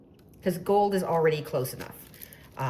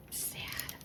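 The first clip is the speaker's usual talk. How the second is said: mock sad whisper; 13 dB quieter than usual. Real, not acted. Mock grief over the spill.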